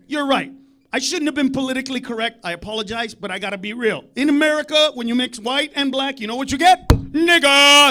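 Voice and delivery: ordinary delivery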